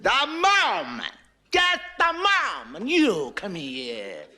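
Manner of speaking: high, slow exasperated Pope; lightly voiced Pope losing his temper; heated Pope. Pope shouting at the atheist.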